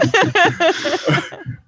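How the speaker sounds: a high, breathy laugh. Real, not acted. Laughing after the punchline, high laugh. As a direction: let the laugh trail off.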